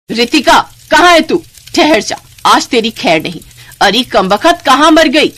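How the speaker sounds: loud, high furious shout. Acted. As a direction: yell her name in rage. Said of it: "गुस्से में भरी आवाज". (गुस्से में चीखते हुए)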